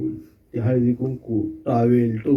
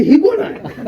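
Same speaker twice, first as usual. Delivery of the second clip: much higher, shrill-pitched mock cry of alarm; startled wife's shriek. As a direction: shriek it like a wife who spots a stranger in the dark. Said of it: "ती जोरात ओरडली". (बायकोचा घाबरून किंचाळलेला आवाज, हसत नक्कल)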